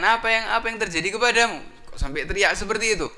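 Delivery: high, loud, urgent questioning; alarmed followers. Acted. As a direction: ask the boss in high alarm. Followers ask their boss iblis.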